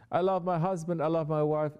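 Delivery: high, whiny mocking voice of a worldly believer; sarcastic imitation of an excuse. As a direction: parody someone excusing their love of the world.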